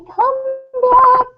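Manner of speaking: calling out. Retold or imitated. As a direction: call them back from far off. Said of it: "I'm shouting to the four corners".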